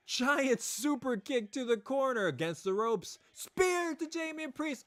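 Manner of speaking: high, excited play-by-play call; wrestling commentator. Acted. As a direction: call every kick like ringside. Live play-by-play of the match.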